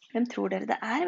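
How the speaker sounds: plain reading voice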